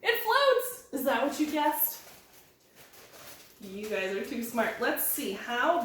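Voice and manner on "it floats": high loud cheer it floats; high happy exclamation; excited reveal. Acted. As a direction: announce the result with delight.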